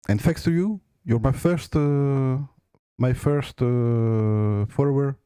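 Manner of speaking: English spoken with a strong French accent; French speaker talking English. Real, not acted. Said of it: "j'ai un accent anglais très, très pourri".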